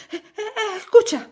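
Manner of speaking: ordinary delivery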